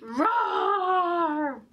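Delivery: loud roaring lion. Shown as a demonstration. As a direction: roar like a lion for the kids. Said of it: "Do it louder".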